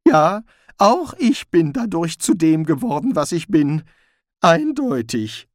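sarcastic Rincewind. A narrator's reading. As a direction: echo him with heavy irony. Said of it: "Rincewind echoes Zweiblum sarcastically".

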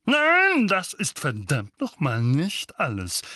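high loud bellow on nein, then slow; angry uncle bellows at Harry. A narrator's reading. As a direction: bleat it out furiously. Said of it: "blökte Onkel Vernon".

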